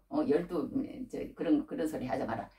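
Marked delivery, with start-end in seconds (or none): none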